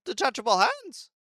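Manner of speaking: a haughty machine monarch who lost but still gloats; robot queen voice. Acted. Queen's punchline.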